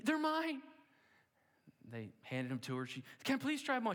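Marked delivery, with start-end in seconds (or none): 0.0-0.6 put-on excited child yell. Girl claiming the package again. 3.2-4.0 eager pleading. Acted. Girl begging to try shoes.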